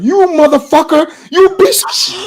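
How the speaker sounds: high, loud imitation of a furious caller; mock angry cursing. Exposed man cursing out the YouTuber by phone, mock fury.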